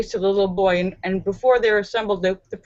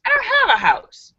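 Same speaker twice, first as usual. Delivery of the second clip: sudden realization; 13 semitones higher than usual. Acted. Joke character realizes he has no house.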